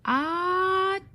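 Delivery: loud high sneeze, letting it burst out; a huge theatrical achoo; big sneeze. Acted.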